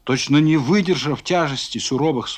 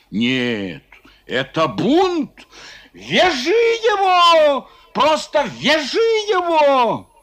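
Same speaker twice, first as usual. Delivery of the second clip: high, slow furious cry; angry shout; 10 semitones higher, much slower than usual. Acted. A merchant shouts to tie Foma, furious. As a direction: demand they tie him up.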